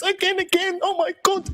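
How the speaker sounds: high, childish mock cheer of online fans. Mocking online fans, childish high voice. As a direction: squeal it like a Teletubby fan. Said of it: "like it's the Teletubbies".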